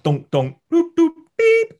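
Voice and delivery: high, loud, slow toot and beep; imitated knocks and whistle. Imitating the bedridden mother's signals, high and loud beeps. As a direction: mimic the clonks then a high whistle. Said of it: "it's like".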